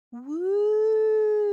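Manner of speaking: long, high, loud ghost wail; high sustained spooky hoot; ghostly wail. A narrator's reading. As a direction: give your best drawn-out woo, like an owl. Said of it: "He let out his best wail".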